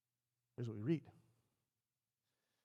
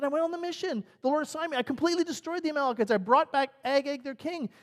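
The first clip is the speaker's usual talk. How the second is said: high, loud, protesting list; insistent self-defence; 11 semitones higher, 7 dB louder than usual. Acted. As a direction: argue your innocence loudly and high. Saul defends himself to Samuel.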